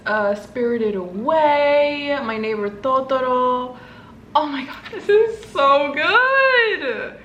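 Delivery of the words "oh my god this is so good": high, slow, stretched-out squeal; gush, swooping up high; ecstatic squeal. Real, not acted. Thrilled by Ghibli stickers.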